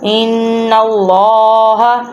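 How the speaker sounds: slow "ان اللہ", nasal held n, thick long l-aa; ghunna and heavy lam. Shown as a demonstration. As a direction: hum the n of "ان", then thicken the l of "اللہ". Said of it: "ادھر گننا بھی ہو رہا ہے". ("ان" پر غنہ اور "اللہ" کا لام موٹا)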